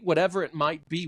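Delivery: ordinary talk, nothing marked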